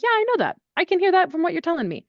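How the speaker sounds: high bright reassuring quote; imitating her own reply. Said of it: "And I'm like".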